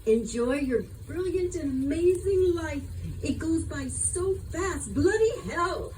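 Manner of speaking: high, slow English accent, imitating a friend; Charlotte's English accent. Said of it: "saying in her own English accent".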